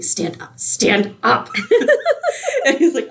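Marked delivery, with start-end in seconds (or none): 0.0-0.5 whispered, urgent hiss through a fake smile; angry hissed whisper. Teen girl to boyfriend proposing in a restaurant, furious whispered hiss. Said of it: "you just see her get so angry". 0.6-1.5 voiced, a touch sharper and higher; mild urgent command. Teen girl to boyfriend kneeling with a ring, mildly exasperated order. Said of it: "you just see her get so angry". 1.5-2.6 high squeal of laughter. Real, not acted. Recalling cringe proposal scene.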